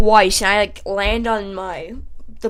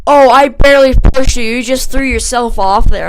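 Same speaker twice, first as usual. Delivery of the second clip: louder, higher mock protest; put-on loud, high denial; retold defensive protest; 4 semitones higher, 7 dB louder than usual. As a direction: imitate him loudly brushing off the blame.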